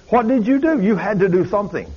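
high, insistent questioning; retold amazed insistence. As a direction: act out an astonished mother demanding to know. Amazed mother presses babysitter, retold.

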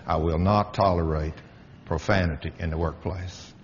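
quiet, resolute conviction; firm and low. Real, not acted. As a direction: drop low and soft, firm.

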